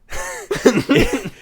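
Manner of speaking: helpless high-pitched giggle; high laugh. Real, not acted. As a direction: crack up mid-sentence. Cracks up at own bit.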